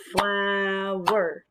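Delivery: FLOW-ER in two long beats; syllable by syllable. Shown as a demonstration. Teacher cueing children to clap, "Flower" in two parts. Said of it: "Two parts, two syllables".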